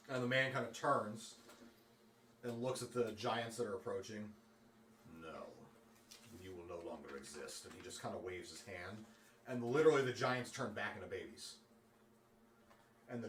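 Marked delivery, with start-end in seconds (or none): 5.2-7.6 low, much softer, calm and deadly; quiet menace. Acted. Man to the approaching giants, soft menacing verdict. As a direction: pass sentence without raising your voice.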